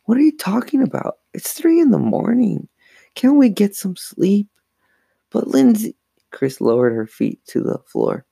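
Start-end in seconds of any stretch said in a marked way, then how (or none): 0.0-4.5 high, whiny protest from bed; sleepy complaint. A narrator's reading. Woken sister to her twin at three a.m., sleepy whine. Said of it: "Lindsay asked sleepily". 5.3-6.0 high, loud plea; pleading protest. A narrator's reading. Frightened girl to her sleepy twin, urgent pleading.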